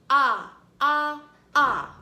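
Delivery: AH said loud as short O in octopus; short O sound. Shown as a demonstration. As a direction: belt out AH like the start of octopus. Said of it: "One more time".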